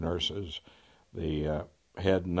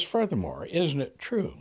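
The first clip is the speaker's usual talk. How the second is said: mock courtroom pressing; 9 semitones higher than usual. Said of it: "he's almost shouting now".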